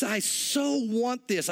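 slow, yearning emphasis on "so"; stress on "so". Acted. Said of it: "it's a double, uh, emphasis".

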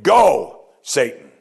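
sharp imperative on Go, then Satan dropped low; commanding. Shown as a demonstration. Preacher voices Jesus rebuking the devil, commanding. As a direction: order him off like a command. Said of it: "Imperative mood in the Greek".

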